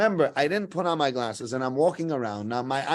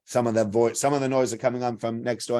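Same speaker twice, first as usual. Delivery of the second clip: ordinary delivery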